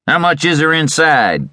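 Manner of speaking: high-pitched Western tough; demanding robber. A narrator's reading. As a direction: demand it like an outlaw holding a man up. Robber questioning his victim.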